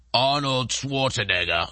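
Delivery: low, slow Schwarzenegger-style Austrian accent; bad Schwarzenegger accent. Shown as a demonstration. Host showing an accent like Arnold's, bad Schwarzenegger accent. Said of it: "that's probably a horrible impression".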